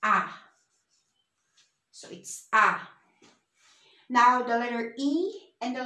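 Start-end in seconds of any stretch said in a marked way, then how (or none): 0.0-0.2 "A" said as an open "ah"; Spanish A sound. Shown as a demonstration. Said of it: "would always sound as". 2.5-2.8 "A" said as an open, falling "ah"; Spanish A sound. Shown as a demonstration. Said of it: "would always sound as".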